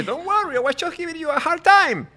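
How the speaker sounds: high, teasing put-on voice; mock reassurance. To the immigration officer, a high teasing reassurance. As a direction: tease them that it was only a joke.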